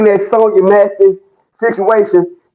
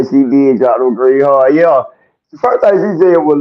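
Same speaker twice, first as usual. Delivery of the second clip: normal talking voice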